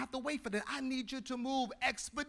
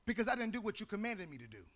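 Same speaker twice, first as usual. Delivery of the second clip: usual speaking voice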